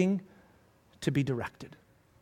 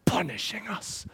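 hushed word; whispered; 6 dB quieter than usual. Real, not acted. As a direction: let the word fall to a whisper. Drops to whisper.

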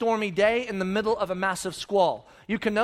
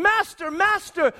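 panicked plea; 7 semitones higher, much slower than usual. Acted. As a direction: beg the master in terror.

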